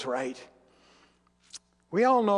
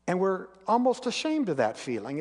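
usual speaking voice

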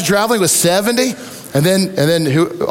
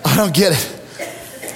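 laughing; 5 dB quieter, much slower than usual. Real, not acted. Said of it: "it swells this part right here".

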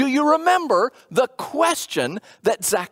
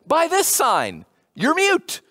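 pointed reply; 7 semitones higher, slower than usual. Retold or imitated. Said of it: "And the angel says".

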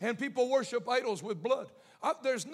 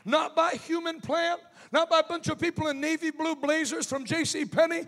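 loud fiery preacher shout; shouted preaching; 3 semitones higher, 15 dB louder than usual. Acted. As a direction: preach at full force, building. Preaching climax to congregation.

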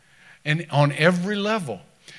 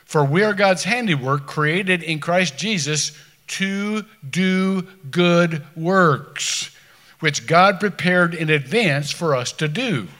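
loud, slow scripture proclamation; forceful preaching; 2 semitones higher, 4 dB louder, slower than usual. Acted. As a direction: proclaim it to the back row.

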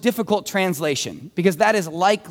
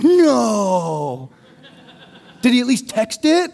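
put-on drawn-out, louder comic "kid" protest; mock shocked kids; 3 semitones higher, 4 dB louder, slower than usual. Preacher mimics kids hearing Mark had no computer, mock shocked. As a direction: put on an incredulous kid's voice. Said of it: "the kids were like".

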